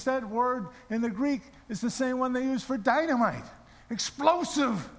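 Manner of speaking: high-pitched retold preaching; mentor's fervent mini-sermon; impassioned sermon. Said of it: "gave me a twenty-minute sermon".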